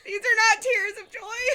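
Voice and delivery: half-laughing sob through a stuffy nose; snotty crying. Real, not acted. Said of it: "I'm crying".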